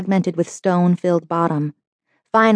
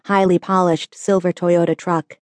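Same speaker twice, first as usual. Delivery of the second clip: ordinary delivery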